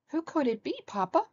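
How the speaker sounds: high, questioning little bear voice; high-pitched childlike question; wondering baby bear. A narrator's reading. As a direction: wonder aloud as a small cub. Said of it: "wondered Baby Bear".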